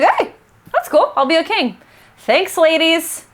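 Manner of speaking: high-pitched buddy-style banter; breezy joke, drawing out the thanks; playful, casual. Acted. Macbeth beside his BFF Banquo thanking witches, playful. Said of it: "just lean into it with his BFF".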